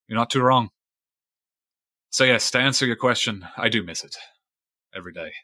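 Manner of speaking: lightly voiced weathered fisherman recounting a grudge; a hint of salty sea-dog storyteller; old sailor Fisheye.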